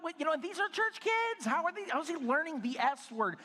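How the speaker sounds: high-pitched, incredulous protest; indignant father. Retold or imitated. Dad wondering where his son learned swearing, high and indignant. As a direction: exclaim it in mock outrage, pitched well up.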